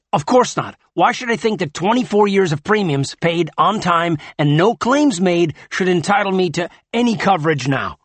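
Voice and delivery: loud, high, indignant sarcasm; spat out angrily. A narrator's reading. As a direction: spit out the complaint in outrage. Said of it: "spat Dez".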